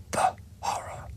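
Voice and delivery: "The horror" breathed out unvoiced; mock-horrified whisper. Host mocking the minister in a whisper. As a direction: whisper it like a ghost story.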